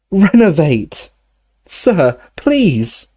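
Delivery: high, drawn-out spell then a plea; desperate cry. Acted. Harry trying again to revive Dumbledore, high desperate cry. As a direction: call out high, pleading for a response.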